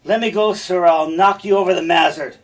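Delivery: loud, high angry threat; hot-headed stage shout; Cassio threatening. Acted. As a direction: bluster at someone holding you back. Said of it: "And Cassio's all".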